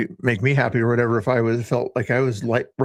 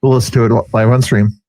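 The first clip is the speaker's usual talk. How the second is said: much louder, eager and quick; excited host; 9 dB louder than usual. Real, not acted. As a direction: pump up the caller to go ahead, fast. Host excited about caller's impression.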